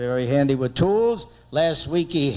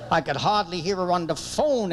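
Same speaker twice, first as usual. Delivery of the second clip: usual speaking voice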